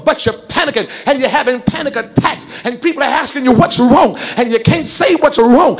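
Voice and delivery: preaching that builds to a loud push; driving preaching. Real, not acted. Preacher tells worriers the devil fights in the mind, driving preaching. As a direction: press each clause on the congregation, gathering force.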